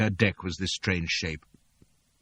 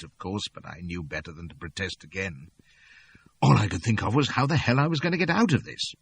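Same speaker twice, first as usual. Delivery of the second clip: ordinary delivery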